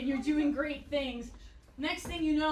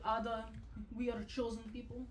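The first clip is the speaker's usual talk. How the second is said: dad voice; 3 semitones lower, 8 dB quieter than usual. Retold or imitated. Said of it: "He's like".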